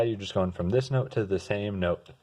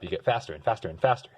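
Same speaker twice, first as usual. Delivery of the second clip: getting faster and faster; speeding up; faster than usual. Shown as a demonstration. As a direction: rush ahead, accelerating. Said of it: "You speed up".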